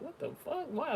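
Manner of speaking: very high, baffled what-the-fuck; baffled squeak. Retold or imitated. She answers the cursing driver, baffled squeak. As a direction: squeak out pure confusion. Her own puzzled reply.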